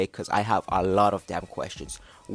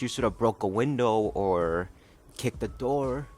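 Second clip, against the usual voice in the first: normal talking voice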